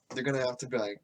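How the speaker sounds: normal talking voice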